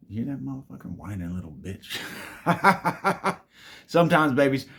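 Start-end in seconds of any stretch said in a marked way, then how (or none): 2.0-3.4 a loud, high rising laugh; high laughing fit. Real, not acted. Mocking the whining cat outside, high laugh.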